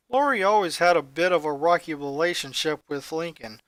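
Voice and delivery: plain reading voice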